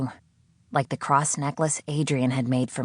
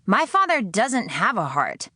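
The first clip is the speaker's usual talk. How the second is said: higher, sharp bitter retort; 7 semitones higher than usual. Acted. Sydney retorting to her captor, higher bitter snap. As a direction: fire it back without thinking.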